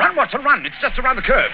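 high shouted urging on the run; urgent shout. Acted. Holmes urging Watson to outrun the train, shouting.

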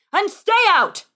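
loud, high-pitched angry yell; burly man yelling. Acted. Tavern man throwing boy into street, angry yell. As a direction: yell after someone being thrown out. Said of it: "yelled a burly man from inside the building".

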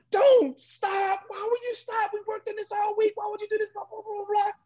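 high, urgent pleading at the receiver; frustrated yelling. Retold or imitated. Yelling at teammate on field.

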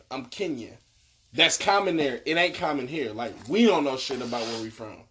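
ordinary talk, nothing marked

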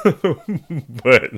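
slow plea broken by laughter; laughing plea. Real, not acted. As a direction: laugh through the words. Laughing while pleading.

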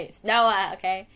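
emphatic drilling. Shown as a demonstration. Vlogger making listeners repeat the name, loud emphatic drilling. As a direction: call it out forcefully for repetition. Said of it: "say again with me".

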